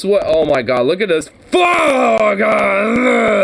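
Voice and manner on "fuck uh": high, drawn-out yell into a groan; exasperated shout. Real, not acted. Streamer revolted by the host's face, exasperated yell. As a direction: howl it in disgust.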